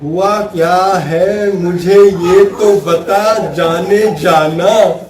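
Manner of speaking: very slow, honeyed and gentle; husband sweetly asking his wife what's wrong, very slow; slow tender coaxing. Shown as a demonstration. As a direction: stretch each word lovingly to calm her. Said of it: "आपको उससे बात करनी है".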